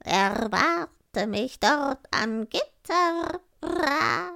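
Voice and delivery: high, slow crow voice with loud caws; raspy cawing crow. Acted. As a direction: croak it proudly like a talking crow. Crow speaks in the tale.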